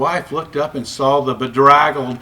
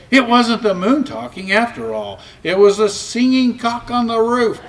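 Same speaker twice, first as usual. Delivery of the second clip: plain reading voice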